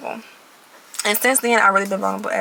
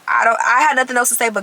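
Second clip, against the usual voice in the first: ordinary talk, nothing marked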